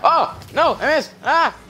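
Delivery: high loud dismayed cry; frustrated yell. Real, not acted. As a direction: yelp at the miss. Missing a shot.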